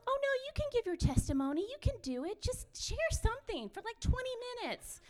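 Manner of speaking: lightly mocking high voice of the friend; chirpy friend. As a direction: sing it up high like an eager cheerleader. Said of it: "She's like".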